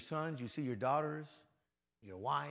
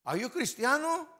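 high, pointed question in a put-on voice, cristiano; a neighbor asking in Spanish; questioner's voice; 11 semitones higher, 5 dB louder than usual. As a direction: put someone on the spot. The asker presses again.

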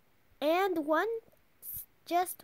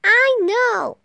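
loud, high "I know"; high, drawn-out, loud retort; crying out; 7 semitones higher, 14 dB louder than usual. A narrator's reading. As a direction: cry it out like an impatient kid. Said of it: "said, cried Froggy".

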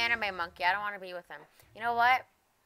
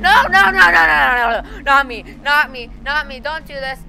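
panicked pleading; 7 semitones higher, 15 dB louder than usual. Real, not acted.

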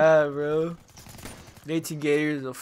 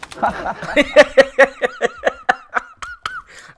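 very high, loud shrieking laughter; 6 dB louder than usual. Real, not acted. Cracking up after imitating a gamer, high shrieking laugh.

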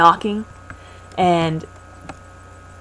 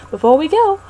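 Pokey voice; 9 semitones higher, 4 dB louder than usual. Acted. Voicing Pokey character.

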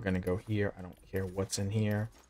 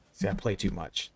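ordinary delivery

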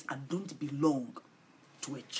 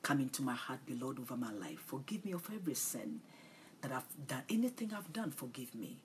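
soft prayer; 6 dB quieter than usual. Shown as a demonstration.

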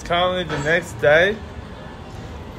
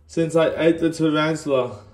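numb swollen mouth. Real, not acted. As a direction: talk like your whole jaw stings. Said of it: "I cannot even speak".

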